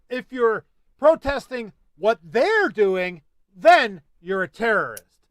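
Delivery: slow, loud pointed outrage; indignant. Real, not acted. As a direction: hammer each word slowly.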